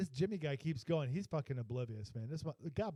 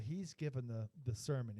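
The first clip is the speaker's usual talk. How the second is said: normal talking voice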